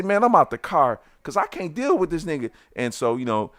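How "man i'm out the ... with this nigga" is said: higher, exasperated; fed-up exit. Retold or imitated. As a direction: announce you're leaving, fed up. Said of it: "I say".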